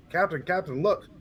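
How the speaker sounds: trying to grab the captain's attention; eager call. Acted. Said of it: "I'm jumping up and down".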